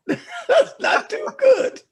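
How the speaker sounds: high, slow laughing through the words; laughing at a backhanded compliment. Real, not acted.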